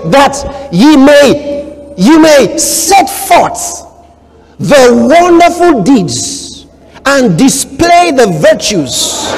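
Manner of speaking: high, slow preaching read; preacher savoring the verse; preaching scripture. Acted. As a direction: draw every word out from the pulpit.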